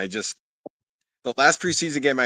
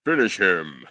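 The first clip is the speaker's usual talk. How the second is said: lower, drawn-out mock announcer growl; game announcer impression; 5 semitones lower, slower than usual. Podcast cohost answers friend with lower mock announcer call. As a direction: boom it like the fight game's announcer. Echoing Mortal Kombat announcer.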